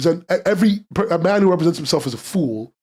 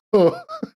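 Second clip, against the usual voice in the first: laugh. Real, not acted. Crying laugh.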